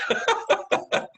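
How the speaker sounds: quick high laugh; a brief, real laugh at the joke; short laugh. Real, not acted. Laughing at his own quip about hearts stopping, short high laugh.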